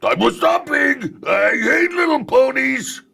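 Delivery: very high, loud, slow outraged shout; very high, loud, slow royal tantrum; Prince Rutherford. Acted. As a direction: rage at the ponies like a pompous prince. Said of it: "he goes".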